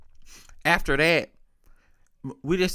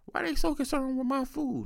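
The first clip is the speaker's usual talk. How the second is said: granddad's puzzled question, pitched much higher; lightly voiced old man, higher and soft; imitating granddad; 12 semitones higher, 4 dB quieter than usual. Grandson mimics his granddad asking about the food, high and puzzled.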